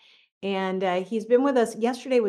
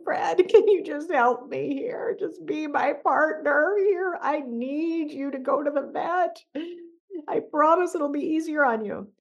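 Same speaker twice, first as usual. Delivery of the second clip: high, slow pleading through tears; begging the cat, half crying; tearful plea; 7 semitones higher than usual. Retold or imitated. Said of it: "in total tears".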